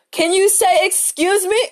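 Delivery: high, loud, exasperated call-out; indignant demand. Retold or imitated. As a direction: demand it like she owes you an apology. Mocking rude shopper who won't excuse herself.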